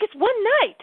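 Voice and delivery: high-pitched exasperated comeback; mad retort. Retold or imitated. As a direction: protest sharply, pitch shooting up. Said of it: "My cats now think I'm mad at them".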